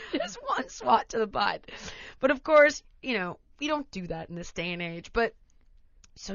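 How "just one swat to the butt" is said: laughing. Retold or imitated. Laughing continuation.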